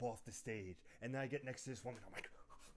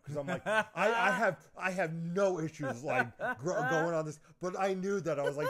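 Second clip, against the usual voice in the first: loud laughing, high; 6 semitones higher, 11 dB louder than usual. Real, not acted. Telling the host about the stripper scene, laughing. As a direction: laugh through the memory.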